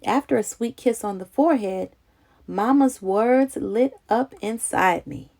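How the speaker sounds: plain reading voice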